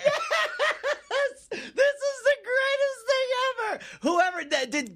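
very high laughing squeal; ecstatic, high-pitched glee; real delighted shrieking. Real, not acted. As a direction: exult helplessly through laughter, voice way up.

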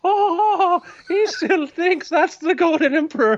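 high, giggling snobbish voice; foppish laughing. Acted. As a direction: speak through a high titter. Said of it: "does this foppish laugh".

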